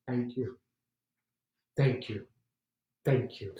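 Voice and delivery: a quiet, humble emperor; soft thanks. Acted.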